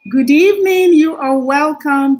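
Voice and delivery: high, loud cheerful evening greeting; bright greeting. Real, not acted. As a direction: greet the whole stream with a lift. Host greets livestream audience.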